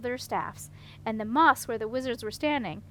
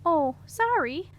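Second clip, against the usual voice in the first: apologetic; 5 semitones higher, 5 dB louder, slower than usual. A narrator's reading. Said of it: "the prince apologized".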